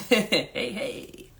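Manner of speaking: usual speaking voice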